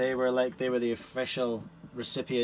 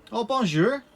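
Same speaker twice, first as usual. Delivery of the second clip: playful French greeting; 11 semitones higher, 9 dB louder than usual. Pretend.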